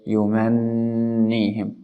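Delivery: long nasal n in "yumannihim" before the "i"; ghunna, then kasra. Shown as a demonstration. Teacher explaining the order, held humming n in "yumannihim". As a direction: hold the n of "yumannihim", then release into "ni". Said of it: "After the shadda and after you pronounce the".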